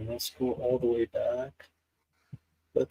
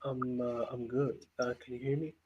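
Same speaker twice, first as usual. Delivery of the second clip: faint, faraway voice through a weak mic; barely coming through, like a dying microphone; barely audible; 8 dB quieter than usual. Real, not acted. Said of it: "your voice is very low".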